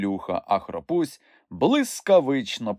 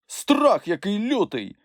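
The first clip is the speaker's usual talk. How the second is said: higher, slow frightened marvel; awed fright; 11 semitones higher, slower than usual. A narrator's reading. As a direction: shiver at the beast. Scared Sniff-like voice. (налякане захоплення)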